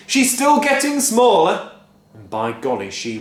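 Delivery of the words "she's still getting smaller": high, loud, slow gloat; gleeful shout. A narrator's reading. Father gleefully shouting as Grandma shrinks. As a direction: shout with glee. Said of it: "shouted Mister Cranky gleefully".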